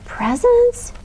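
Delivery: high squeaky gasp of disbelief; a tiny mouse, aghast; shocked squeak. A narrator's reading.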